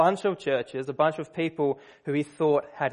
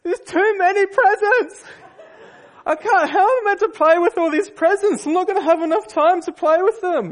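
put-on much higher and louder, a spoiled child's wail; whiny wailing; 8 dB louder than usual. Pessimistic son wails at dad over too many presents. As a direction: wail it like a kid drowning in presents. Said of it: "and he's just crying".

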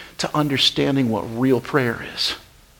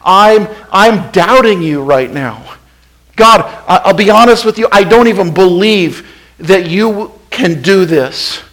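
doubting prayer; 5 semitones higher, 8 dB louder than usual. Acted. Preacher voicing his own doubting prayer to God, raised and pleading.

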